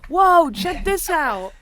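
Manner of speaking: loud, slow, excited whoa; drawn-out delighted call. Real, not acted. Finds a gadget.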